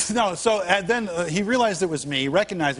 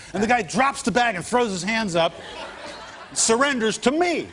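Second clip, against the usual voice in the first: laughing through the punchline, rising high; telling it while cracking up; chuckling as the story peaks; 5 semitones higher than usual. Real, not acted.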